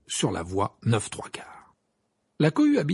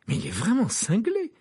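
high-pitched alarmed disbelief; a touch of fright in the voice; almost frightened; 12 semitones higher than usual. Acted. Fred reacting to Harry's gift, almost frightened. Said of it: "dit Fred d'une voix presque effrayée".

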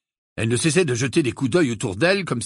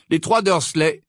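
plain reading voice

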